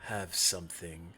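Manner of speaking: low, quiet, ominous creature; low menacing being. Acted. GM voices the being.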